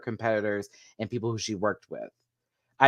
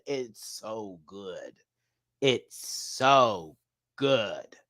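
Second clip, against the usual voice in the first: very slow, drawn-out praise; slow, word-by-word swoon; savouring; 4 semitones lower, much slower than usual. Real, not acted. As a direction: let each word linger in admiration. Gushing winds down, word by word.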